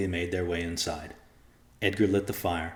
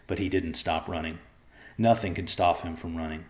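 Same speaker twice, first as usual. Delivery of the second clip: ordinary delivery, nothing marked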